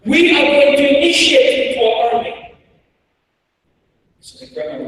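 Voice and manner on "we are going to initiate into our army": high, loud, chanted proclamation; rebel commander. Retold or imitated. Rebel commander addressing lines of captured kids, high loud proclamation. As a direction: declare it loudly to the whole line. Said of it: "rebel commander starts walking through the lines and he starts saying".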